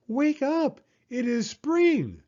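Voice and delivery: high, eager wake-up call; excited shout. Acted. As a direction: rouse a sleepy friend with joy. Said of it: "shouted Frog".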